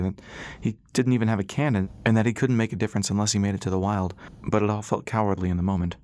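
ordinary talk, nothing marked

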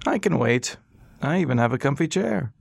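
patient, polite, in no hurry; old doctor. Acted. Old doctor to silent Ras, high and courteous.

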